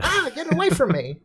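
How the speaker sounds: high squeal, mock panic at a spider. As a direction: shriek like you saw a spider. Player thinks puck is spider.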